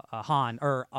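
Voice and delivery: ordinary delivery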